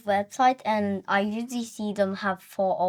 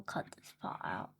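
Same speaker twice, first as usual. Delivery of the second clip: whispering; 17 dB quieter than usual. Real, not acted. Aside about editing.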